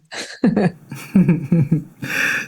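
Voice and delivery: a short real laugh at her own story. Real, not acted. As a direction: let out a light, amused laugh.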